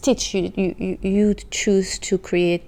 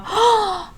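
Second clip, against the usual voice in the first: sharp high gasp; delighted gasp; 12 semitones higher than usual. Real, not acted. A fan spotting the logo, high gasp. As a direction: gasp at the tiny paw print. Sees the paw print.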